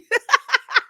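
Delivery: real loud laugh; high amused laugh; high burst of laughter. Real, not acted. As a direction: laugh out at the absurdity. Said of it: "Girl, are you a pastor?".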